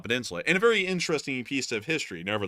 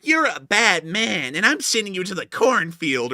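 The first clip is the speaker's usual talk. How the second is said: put-on higher, louder, eerie child's threat; creepy Twilight Zone kid; 7 semitones higher, 6 dB louder, slower than usual. Streamer imitating the Twilight Zone kid to Matthew, high and loud. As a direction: pronounce the banishment like a spooky child. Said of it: "that kid from The Twilight Zone".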